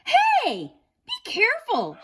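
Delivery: put-on high, loud girlish shout; girl's shout. A narrator's reading. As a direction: call out in a high girl's voice to warn him. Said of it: "she shouted".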